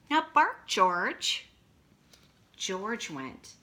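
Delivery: slow, lively picture-book reading; reading to children. A narrator's reading. Teacher reading a picture book to her class, slow and lively. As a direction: read it out big for kindergartners.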